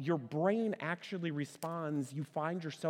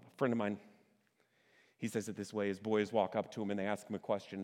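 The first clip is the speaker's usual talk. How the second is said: normal talking voice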